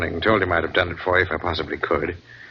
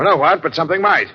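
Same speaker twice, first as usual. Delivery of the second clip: loud, higher, insistent; emphatic; 8 semitones higher, 4 dB louder than usual. Acted. Captain insisting to Christopher, loud and high. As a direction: hammer the point home.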